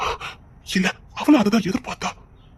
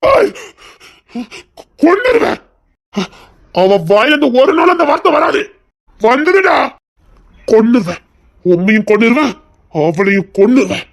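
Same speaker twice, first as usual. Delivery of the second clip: enraged threat; 2 semitones higher, 3 dB louder than usual. Acted. Husband raging at the father-in-law. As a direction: threaten to kill with fury.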